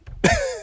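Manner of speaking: short, very high disbelieving laugh; incredulous laugh. Real, not acted. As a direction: laugh at the absurdity. Incredulous laugh mid-rant.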